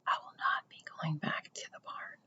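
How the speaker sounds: barely audible whisper; faint whisper from a dying spider; very low voice. A narrator's reading. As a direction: breathe it out so he can hardly hear. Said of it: "spoke in a voice so low Wilbur could hardly hear".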